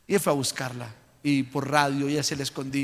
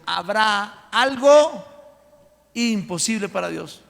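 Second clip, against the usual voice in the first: loud, slow, drawn-out proclamation, higher; proclaiming; 5 semitones higher, 8 dB louder, much slower than usual. Acted. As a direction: proclaim it to the whole church. Cita repetida con fuerza. (proclamación fuerte y lenta, más aguda)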